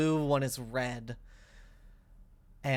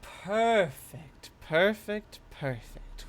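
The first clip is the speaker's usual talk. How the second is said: pleased spirit voice; 7 semitones higher, slower than usual. Acted. Spirit purrs approval.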